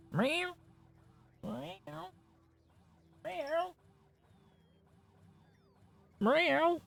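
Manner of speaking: squeaky high mock meows, one after another; meowing at cat. To his cat, calling it over with high meows.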